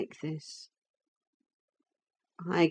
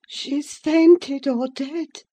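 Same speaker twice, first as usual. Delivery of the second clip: slow, raised-pitch musing; grim inner thought; 8 semitones higher, 4 dB louder, much slower than usual. A narrator's reading. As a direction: think it aloud, drawing it out. Narrator voices her own shocked thought.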